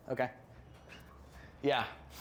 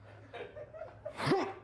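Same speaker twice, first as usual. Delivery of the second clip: a pinched, high suppressed cough sound; high squeaky stifled cough. Shown as a demonstration. Showing the crowd a held-in cough. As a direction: choke it back high in the throat.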